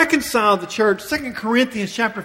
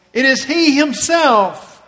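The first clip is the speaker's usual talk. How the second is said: He Himself punched louder and slowly; emphatic He Himself; 3 semitones higher, 5 dB louder than usual. Shown as a demonstration. Preacher showing the congregation the verse's emphasis, He Himself stressed. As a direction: lean hard on both words, He Himself, stretching them out. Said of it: "notice the emphasis on Himself".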